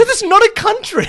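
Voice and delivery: exasperated protest. Real, not acted. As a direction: burst out in comic exasperation. Exasperated over made-up country.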